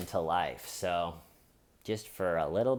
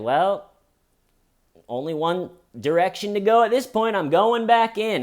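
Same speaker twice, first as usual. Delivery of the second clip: higher, louder mock resolve; lightly voiced snack craver; 10 semitones higher, 6 dB louder than usual. Shown as a demonstration. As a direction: play the hooked snacker. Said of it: "it's like".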